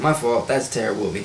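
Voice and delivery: ordinary talk, nothing marked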